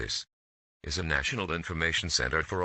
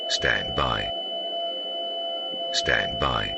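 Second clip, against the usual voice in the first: loud, formal broadcast read over music; emergency alert announcer; 5 semitones higher, 4 dB louder than usual. Acted. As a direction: call it out like an emergency alert system on air.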